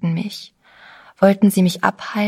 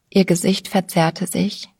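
ordinary delivery, nothing marked

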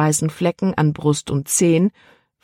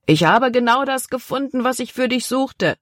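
higher-pitched, pleased announcement; excited call; 8 semitones higher than usual. A narrator's reading. Father calls to daughter about his find, excited call.

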